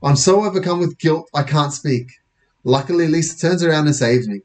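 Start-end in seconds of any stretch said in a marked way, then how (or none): none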